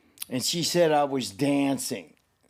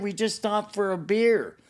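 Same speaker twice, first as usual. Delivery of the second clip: higher-pitched defensive retort; pitched-up defensive retelling; raised retold excuse; 8 semitones higher than usual. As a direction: tell her off in a raised voice. Retold reply to angry wife.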